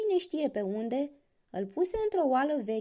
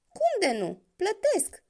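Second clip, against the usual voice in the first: eager; 8 semitones higher, 4 dB louder, slower than usual. A narrator's reading. Dracul acceptă entuziasmat.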